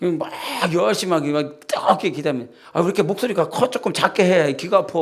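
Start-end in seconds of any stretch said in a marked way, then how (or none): 2.7-4.9 higher, louder irritated scolding; annoyed complaint. Retold or imitated. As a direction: complain that the prayer hurts your ears. Pastor scolding a loud prayer. (시끄럽게 기도하는 신자에게 짜증 내는 목사, 높고 큰 목소리로)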